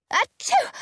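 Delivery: big high sneeze, achoo; loud sneeze. A narrator's reading. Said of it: "I sneeze again".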